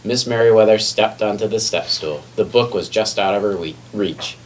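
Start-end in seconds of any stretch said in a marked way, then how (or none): none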